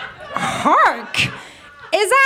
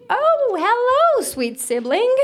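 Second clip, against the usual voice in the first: sing-song greeting; 9 semitones higher than usual. Acted. Train character greeting sibling.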